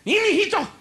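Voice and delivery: high-pitched cry to her son. Acted. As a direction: yelp it like a mom at the wheel. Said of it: "My mom would be driving".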